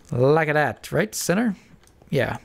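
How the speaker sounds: normal talking voice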